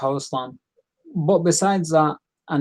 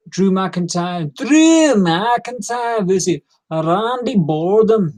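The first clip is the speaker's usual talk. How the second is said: drawn-out, louder ring announcer call, higher; 4 semitones higher, 4 dB louder, much slower than usual. Pretend. Wrestling vlogger hypes up the next match like an announcer.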